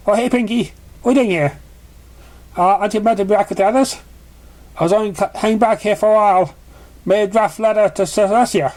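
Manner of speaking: friendly unicorn voice. A narrator's reading. Purple unicorn casually chatting to Pinky, light and friendly. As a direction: voice the purple pony, cheerful and offhand.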